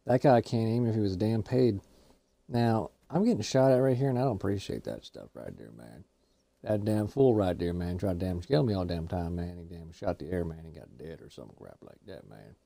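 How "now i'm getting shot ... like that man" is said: low, soft mumbling man-filled ramble; Boomhauer. Acted. Boomhauer grumbling to his buddy about getting shot, low mumble. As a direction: mumble it low and quiet, trailing off.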